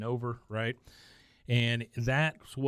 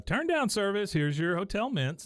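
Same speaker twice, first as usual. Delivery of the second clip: lightly acted room-service announcement, pitched higher; hint of a boy playing hotel staff; hotel service call; 9 semitones higher than usual. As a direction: sing out a mock turndown service. Acting Bobby announcing room service.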